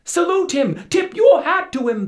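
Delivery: high, loud desperate pleading; frightened crew begging the captain to salute the imp; crew crying out. Acted. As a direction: beg the captain over the wind. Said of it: "The crew then cried out".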